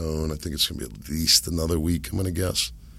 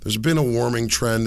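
usual speaking voice

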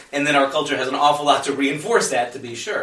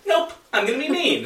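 much higher, cheeky stubborn little voice; mock inner brat; 11 semitones higher than usual. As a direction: play the defiant part of yourself. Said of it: "there's this part of me that's like".

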